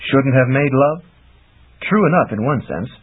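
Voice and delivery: ordinary delivery, nothing marked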